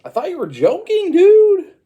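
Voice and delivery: very high, loud cartoon wail; mock distraught yell. Mario to Luigi after the confession, high distraught yell.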